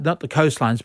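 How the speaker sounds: ordinary talk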